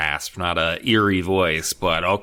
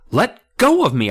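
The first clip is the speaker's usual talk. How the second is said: high, loud panicked plea; cry out; 10 semitones higher, 6 dB louder than usual. Acted. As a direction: pull free and cry out. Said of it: "I cried".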